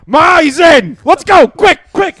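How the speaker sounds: loud, high, urgent yelling; yelling to mom that Greg agreed to ride; excited shout. Retold or imitated. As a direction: call out to mom, thrilled he's in.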